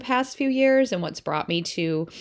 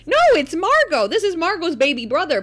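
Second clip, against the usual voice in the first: much higher, louder correcting; insistent child; 10 semitones higher, 6 dB louder than usual. Retold or imitated. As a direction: set dad straight like a little know-it-all.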